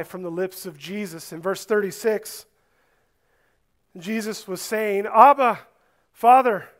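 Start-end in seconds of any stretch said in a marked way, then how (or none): 5.1-6.7 loud, higher cry to a father; anguished cry. Acted. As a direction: call out to your dad in anguish. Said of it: "you can hear the inflection in his voice".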